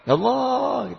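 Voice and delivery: high, drawn-out cry to God; demonstrated high-pitched prayer cry; calling out. Shown as a demonstration. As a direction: call upward with conviction. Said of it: "Teriak, oh, saya yakin".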